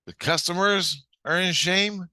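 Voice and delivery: high, astonished query; incredulous question. Retold or imitated. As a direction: ask it in disbelief, pitch shooting up. Astonished question in retold dialogue.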